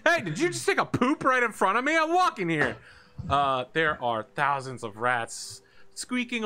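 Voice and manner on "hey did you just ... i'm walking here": very high, loud, fast protest; indignant rat. Acted. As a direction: yell it like an affronted rat in a crowd.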